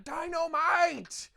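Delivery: put-on high, slow drawn-out drunken yell; drunken heckle. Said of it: "people just being like".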